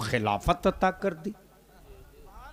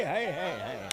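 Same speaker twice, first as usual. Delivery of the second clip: usual speaking voice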